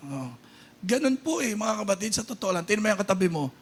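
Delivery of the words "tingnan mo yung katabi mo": ordinary talk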